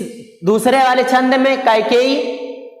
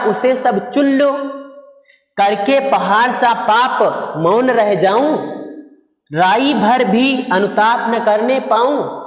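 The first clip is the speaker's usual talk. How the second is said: poem recitation. Acted. Teacher reciting Kaikeyi's verse to students, measured poem recitation. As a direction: recite the verse deliberately, pausing between phrases.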